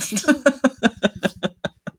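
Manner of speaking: a burst of laughter; laughing at her own rant. Real, not acted.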